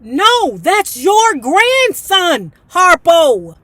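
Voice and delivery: high, loud, very slow retort in a put-on voice; indignant retort. Reader firing back at the father, high and indignant. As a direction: stretch out the correction, high and loud.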